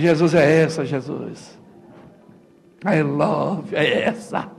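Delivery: low, soft and breathy, as if confiding to God; hushed retold prayer. Pastor retelling his prayer on first seeing his wife, hushed.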